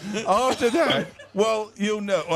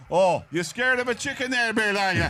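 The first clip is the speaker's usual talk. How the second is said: Irish builder teasing; 3 semitones higher than usual. Retold or imitated. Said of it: "They're going".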